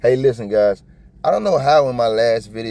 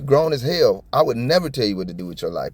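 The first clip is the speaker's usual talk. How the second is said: ordinary talk, nothing marked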